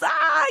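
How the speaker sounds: high-pitched squeal of joy; excited squeal. Retold or imitated. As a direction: let out a fangirl "ah".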